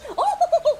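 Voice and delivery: high, loud mock "oh"; mock exclamation. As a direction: whoop out a high-pitched oh. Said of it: "And I was like".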